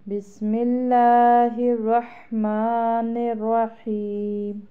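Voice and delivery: drawn-out, chanted recitation of the opening; slow Quran recitation. Shown as a demonstration. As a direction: recite slowly with tajweed. Opens lesson with Quran recitation.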